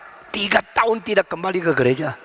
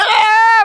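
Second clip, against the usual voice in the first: loud, high imitated child's wail; near-crying wail; 8 dB louder than usual. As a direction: bawl like a scared toddler. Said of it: "mau nangis". (tiruan rengekan keras anak mau nangis)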